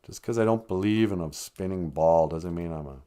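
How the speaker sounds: ordinary talk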